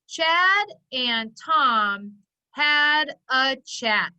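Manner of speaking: each word slow and separate; slow word-by-word pointing. Shown as a demonstration. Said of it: "Let's point it out".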